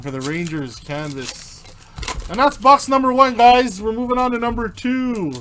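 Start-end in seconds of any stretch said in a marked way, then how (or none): 2.3-5.3 raised, loud, quick box announcement; excited announcement. Real, not acted. Host wrapping up a box for viewers, excited announcement. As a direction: call it out to the whole room.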